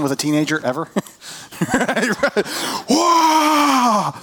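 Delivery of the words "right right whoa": sudden high cry of surprise; a real yelp that breaks out; startled shout. Real, not acted. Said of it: "anyway, sorry".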